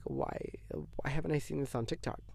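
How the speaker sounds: soft unvoiced whisper, puzzled; whispered question. Viewer whispering, puzzled the album isn't on TikTok. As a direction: whisper it as if confiding a secret. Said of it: "And I'm like".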